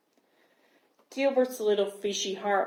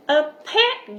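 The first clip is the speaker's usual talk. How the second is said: higher and much louder, thrilled; excited glub; 7 semitones higher, 9 dB louder than usual. A narrator's reading. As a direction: cry out the joy of a new pet. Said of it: "Gilbert glubbed".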